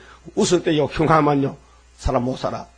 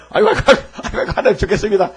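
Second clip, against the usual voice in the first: louder, laughing through 아이고; laughing mock wail; 4 semitones higher, 4 dB louder, faster than usual. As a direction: ham up Adam's cry while laughing. Adam crying out, preacher laughing. (웃으며 아담의 아이고 흉내)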